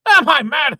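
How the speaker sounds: raging, loud and high; demanding an answer at full volume; furious shout. Acted. Father bellowing his question again.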